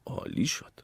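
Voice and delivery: soft mutter to himself; hushed, private remark; under the breath. A narrator's reading. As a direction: mutter it, barely voiced. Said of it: "زیر لب گفت".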